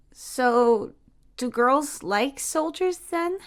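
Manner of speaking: put-on a child working it out aloud; confused little boy. A narrator's reading. Jamie asking Tia if girls like soldiers, puzzled and slow.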